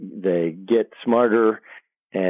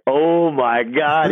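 retold dismay; 6 semitones higher, 3 dB louder, much slower than usual. Retold dismay of new player.

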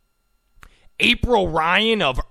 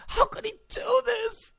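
very high, softer, whiny; mock whimper; 5 dB quieter than usual. Host mocking reporters, very high soft whimper. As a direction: whimper it like a hurt child.

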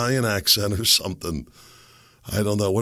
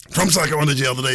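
ordinary talk

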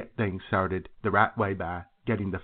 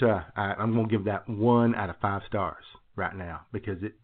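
normal talking voice

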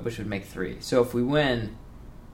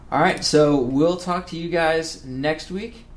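podcast sign-off; 5 semitones higher, 6 dB louder, slower than usual. Acted.

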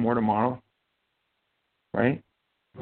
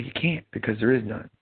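ordinary talk

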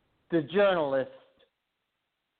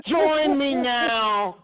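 cult leader; 8 semitones higher than usual. Acted. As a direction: preach to the believers. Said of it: "you sound like a, like an witch cult leader".

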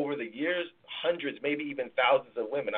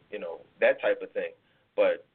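ordinary talk, nothing marked

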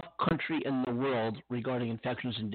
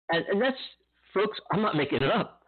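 laughing; 7 semitones higher than usual. Real, not acted. Laughs at absurd news.

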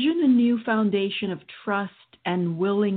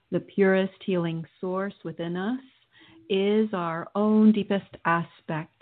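guided meditation. Acted. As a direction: guide them through calmly.

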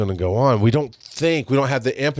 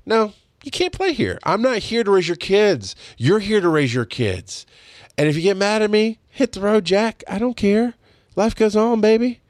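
much higher, cheerful telling-off; happy; 8 semitones higher than usual. Retold or imitated. Telling off a neighbor parent, happily high and upbeat. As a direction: sing out the refusal with glee. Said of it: "What makes me happy is to go".

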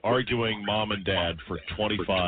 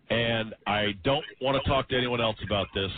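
ordinary talk

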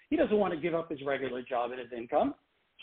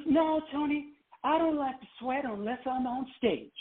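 put-on much higher, light and airy boyish voice; 8 semitones higher than usual. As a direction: pitch it way up for a shy star's excuse. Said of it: "that's my terrible [name] voice".

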